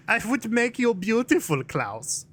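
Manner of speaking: high-pitched cartoon German accent; put-on German doctor voice; German doctor from American Dad. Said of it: "It's the fucking German doctor from".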